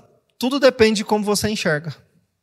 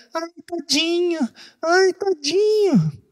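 much higher, slow, whiny mock sympathy; mock pitying voice; 11 semitones higher, much slower than usual. Adult cooing over a hurt child, mock pitying. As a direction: coo it like an overprotective parent.

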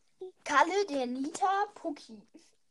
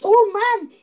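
loud fake whine, higher pitched; mock whining; 6 semitones higher, 9 dB louder, slower than usual. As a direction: whine like a sulking kid. Kids whining while gaming. (gespieltes Jammern)

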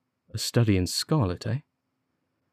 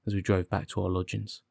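plain reading voice

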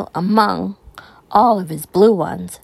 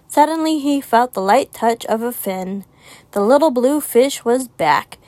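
usual speaking voice

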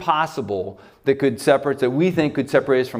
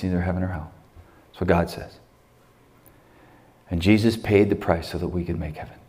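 leading prayer; 4 semitones lower than usual. Acted. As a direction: guide the room into prayer, gently. Leads the closing prayer.

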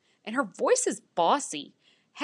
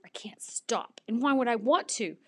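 plain reading voice

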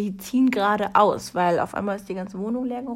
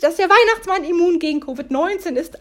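bright, higher and louder news; excited; 7 semitones higher, 7 dB louder than usual. Real, not acted. Telling viewers Santa is Covid-immune: excited. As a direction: share the good news with delight.

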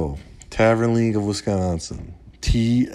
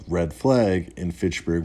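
ordinary talk

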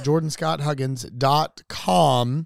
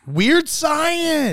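excited; 11 semitones higher, 3 dB louder than usual. Real, not acted. Excited about 1985 movies.

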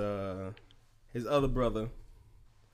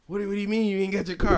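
mildly incredulous, quick and high-pitched; incredulous retort; 7 semitones higher, 4 dB louder, faster than usual. Retold or imitated. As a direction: retell a stammered, disbelieving question, quickly. Retelling his disbelief at missing car.

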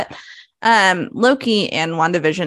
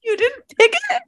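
much higher, stunned disbelief; surprised. Real, not acted. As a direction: ask in shocked disbelief. Disbelief at pick.

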